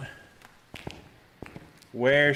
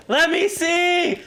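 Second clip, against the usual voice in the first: frantic real plea; pleading cry; 14 semitones higher, slower than usual. Real, not acted. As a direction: beg the game to let you see. Struggling during ghost hunt.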